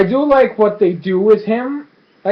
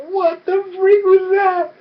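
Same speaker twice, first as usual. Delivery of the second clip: very high, drawn-out mock wail; incredulous squeal; 13 semitones higher, slower than usual. Viewer reacting to the dramatic kiss, incredulous squeal. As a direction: squeal it in hammed-up bafflement.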